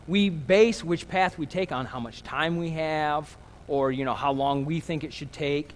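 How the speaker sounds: ordinary talk, nothing marked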